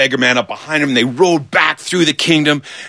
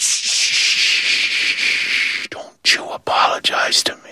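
long shushes into an unvoiced whisper; whispered hushing; 4 dB quieter, slower than usual. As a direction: hush him, then whisper it slowly. King hushes the apologizing beggar.

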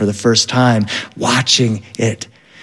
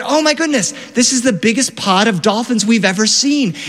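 excited guide; 8 semitones higher than usual. Retold or imitated. Guide excited by dolphin pod.

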